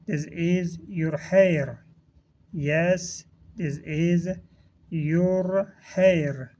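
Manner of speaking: slow, word-by-word teaching delivery; drawn-out words with small gaps between; slow model reading. Shown as a demonstration. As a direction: model the phrase slowly for learners. Teacher models phrase for learners.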